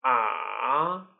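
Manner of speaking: long falling-rising swoop on "Ah"; third tone. Shown as a demonstration. Teacher demonstrates third tone, dipping "Ah". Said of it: "Let's read them one more time".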